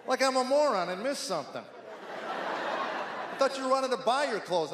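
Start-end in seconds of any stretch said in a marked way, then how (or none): 1.6-4.7 slow, higher, drawn-out sarcasm; sarcastic dad. Retold or imitated. A dad mocking his teenage son's request for money, slow and higher sarcasm. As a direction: stretch it out to mock the teen's logic. Dad's sarcastic retort to son.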